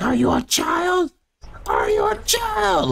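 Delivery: high-pitched mock shriek, words drawn out; imitated yelling. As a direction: mimic her yelling at him, shrill and slow. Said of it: "she's yelling at him".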